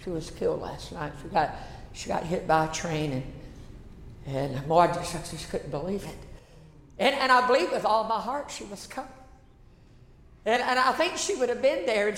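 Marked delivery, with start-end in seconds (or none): 0.0-6.2 very low, hushed and heavy; low, grave voice with halting pauses; somber disbelief. Real, not acted. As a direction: drop the pitch right down, grieving and stunned. Recounts a sudden death in shock.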